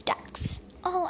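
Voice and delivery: ordinary delivery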